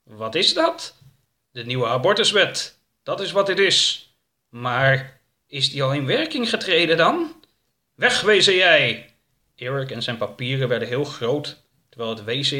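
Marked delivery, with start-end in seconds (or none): 0.0-1.0 slow, higher monster question; slow creature voice, taken aback; startled creature. Acted. 1.5-4.1 loud, commanding hero; raised, powerful announcement; forceful declaration. Acted. Eric triumphantly confronts the creature. 4.5-7.4 doubting creature. Acted. Creature questions Eric. 7.9-9.1 loud, high, drawn-out order; big heroic banishing shout; commanding shout. Acted. Eric orders the creature away.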